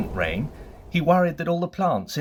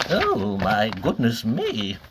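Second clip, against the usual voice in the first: wizard's surprise; 3 semitones lower, slower than usual. Acted. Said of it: "he said, when he saw the size of the list".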